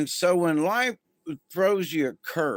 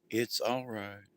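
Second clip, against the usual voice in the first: softly soothing a panicked friend, lower and softer; calming reassurance; 4 semitones lower, 8 dB quieter than usual. Retold or imitated. Calming a panicking relative over the holidays, soft and soothing. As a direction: hush them gently, it's all right. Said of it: "And you go".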